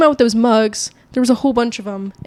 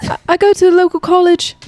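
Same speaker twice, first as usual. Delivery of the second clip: loud, high attempt at transatlantic accent; half-British transatlantic accent try; 8 semitones higher, 5 dB louder than usual. Shown as a demonstration. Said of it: "No, that's just British".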